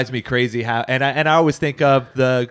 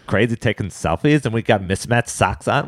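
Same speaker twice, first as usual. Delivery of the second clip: put-on middle school girl voice. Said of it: "I like your middle school girl impression".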